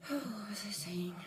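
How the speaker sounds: breathy sigh then a whispered half-question to herself. Real, not acted. Talking to her viewers, she forgets her point, whispering. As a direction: lose the thread and whisper it aside.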